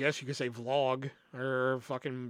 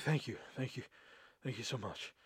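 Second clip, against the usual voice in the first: soft breathless thanks; 3 semitones lower, 11 dB quieter than usual. Retold or imitated. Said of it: "I was like".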